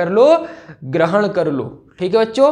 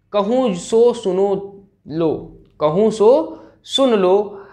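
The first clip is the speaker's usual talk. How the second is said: slow, drawn-out poetry reading; dramatic verse recitation; slower than usual. Acted. Teacher recites Kaikeyi's plea to the assembly, slow dramatic declamation. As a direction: declaim it slowly, pausing between phrases.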